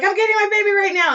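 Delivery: quick, high-pitched flustered dad; high fast mock dad. Dad brushing off the speaker, fast high mock reply. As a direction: rush it out like a caught-off-guard father.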